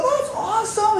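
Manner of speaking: mock thrilled, high-pitched; excited. Preacher voicing a believer thrilled by Jesus' offer, high excited. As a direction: gush like a delighted new believer. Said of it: "And we go".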